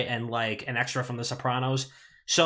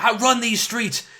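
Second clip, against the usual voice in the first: high, loud street-racer swagger; 11 semitones higher, 8 dB louder than usual. Retold or imitated. Retelling a movie scene to friends, hammed-up tough-guy boast. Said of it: "he's like".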